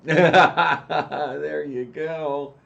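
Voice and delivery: laughing through the words; very slow, loud laugh. Real, not acted. Laughs at Trump joke.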